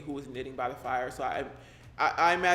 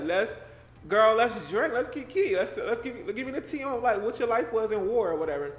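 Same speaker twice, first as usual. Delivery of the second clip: put-on a light, higher party-girl voice; playful wife; 5 semitones higher, 3 dB louder than usual. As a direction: coax the guest to drink and gossip. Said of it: "She was like".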